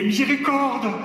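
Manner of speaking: high pleading cry of "Miséricorde"; crying for mercy. Retold or imitated. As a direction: beg for mercy, voice up. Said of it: "je criais".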